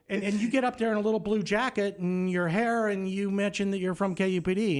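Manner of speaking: high-pitched mock scolding; angry boss. Station owner scolding his intern the next morning, indignant. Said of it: "chewed my ass".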